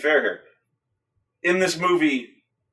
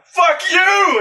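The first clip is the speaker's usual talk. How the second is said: much higher, louder shouted curse; hammed-up furious shout; mock yell; 14 semitones higher, 4 dB louder than usual. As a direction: hurl it while charging. Retelling monster fight.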